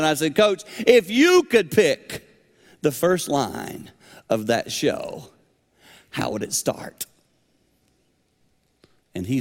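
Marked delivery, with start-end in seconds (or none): 0.3-7.1 slow, rising then dropping to near whisper; slow building question. Retold or imitated. Asking the old coach slowly, fading to a hush.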